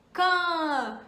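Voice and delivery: "quand" drawn out as one nasal syllable with a silent d; model pronunciation. Shown as a demonstration. Teacher saying how to say quando in French, slow model "quand". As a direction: stretch "quand" clearly for learners to copy. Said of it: "Repete aí comigo".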